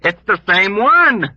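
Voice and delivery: loud, high, slow emphatic shout; emphatic loud cry. Acted. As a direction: shout it over the commotion. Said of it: "Dunbar shouted at him emphatically".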